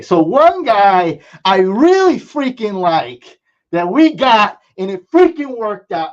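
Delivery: thrilled about the pick he landed; excited. Real, not acted. Gushing about a drafted player.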